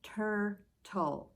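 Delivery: "Turtle" in two separated beats; split into syllables. Shown as a demonstration. Teacher showing children how to count syllables, "Turtle" broken in two. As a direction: say "Turtle" with a gap between tur and tle. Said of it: "I'm gonna say the word".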